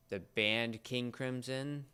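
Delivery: usual speaking voice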